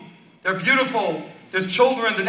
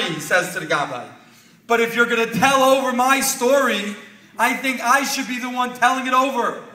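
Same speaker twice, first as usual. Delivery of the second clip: raised, emphatic climax of a story; impassioned preaching; 4 semitones higher, 5 dB louder than usual. A narrator's reading. Speaker reveals who stood up at the dinner, impassioned preaching. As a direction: build the revelation for the congregation.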